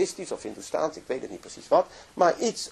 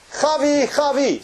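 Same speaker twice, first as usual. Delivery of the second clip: loud high call across a street; 12 semitones higher, 7 dB louder, slower than usual. Retold or imitated. Friend spots Gavi in Mumbai, calling out loudly. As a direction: shout her name to catch her attention.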